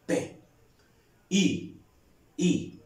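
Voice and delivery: P and I sounded one by one; teacher sounding out P, I for pupils; sounding out letters. Shown as a demonstration. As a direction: give each sound apart, clearly. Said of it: "¿Qué órganos se juntan para producir el sonido P?".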